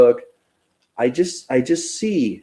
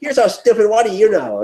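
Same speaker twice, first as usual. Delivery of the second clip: higher-pitched mock contempt; hammed-up scornful jab; mock insult; 7 semitones higher than usual. Imagining a nasty reply to commenters, mock scornful. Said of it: "I just like".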